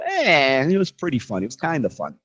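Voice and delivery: half-hearted verdict, dropping in pitch; lukewarm. Shown as a demonstration. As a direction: shrug it off as only so-so. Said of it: "Does work".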